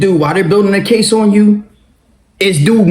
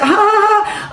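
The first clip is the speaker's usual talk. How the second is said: high, mocking ha ha ha; a scornful staged laugh; 14 semitones higher, slower than usual. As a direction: laugh right in his face. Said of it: "I crack up laughing".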